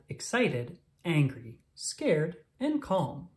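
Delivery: ordinary delivery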